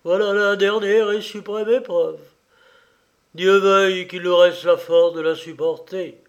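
lightly acted faltering lament; quavering stammer. A narrator's reading. Ruined old father to his daughter, quavering lament. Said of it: "balbutia ce dernier d'une voix chevrotante".

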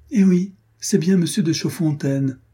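excited recognition. Acted. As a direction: call it out gladly.

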